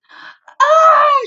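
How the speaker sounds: high loud sputtering cry of disgust; spluttered cry. Acted. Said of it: "she spluttered".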